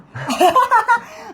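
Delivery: a short high burst of laughter. Real, not acted. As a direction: laugh out at your own tease. Laughing at own teasing.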